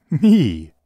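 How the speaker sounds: bright high "Me!" tossed out; playful exclaim. Acted. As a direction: offer herself with a cheeky cry. Playful offer in story.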